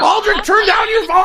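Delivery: high, exasperated yell; shouting. Real, not acted. Host telling caller to turn down the phone, shouting. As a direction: yell over the noise to make it stop.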